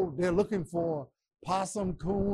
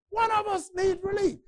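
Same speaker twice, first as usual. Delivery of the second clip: Pharaoh's desperate shout; 12 semitones higher than usual. Acted. Pharaoh begging Moses for relief, high shout. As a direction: cry out for relief as the broken king.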